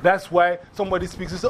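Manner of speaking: ordinary talk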